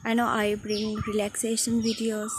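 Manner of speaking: ordinary talk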